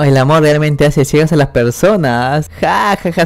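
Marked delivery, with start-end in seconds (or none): 2.6-3.2 acted "ha ha" laugh, higher at first; light put-on laughter. A narrator's reading. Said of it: "se ríe". (risa fingida)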